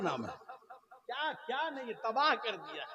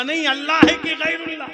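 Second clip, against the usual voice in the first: much higher, louder repeat; shouted demand; 10 semitones higher, 13 dB louder, faster than usual. Acted. Sunni shouting his question again at the cleric. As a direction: insist at full shout.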